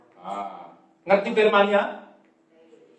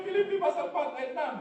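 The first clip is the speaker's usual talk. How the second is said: mimicked answer; 8 semitones higher, 7 dB quieter, faster than usual. Mimics congregation's feeble answer.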